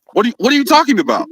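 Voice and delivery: fast, high, exasperated; rapid disbelief. Real, not acted. Streamer scoffing again at the claim, fast and incredulous. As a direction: rattle it off in disbelief.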